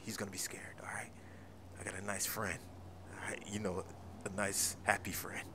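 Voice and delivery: hushed scheming whisper. Acted. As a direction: plot it quietly so he can't hear.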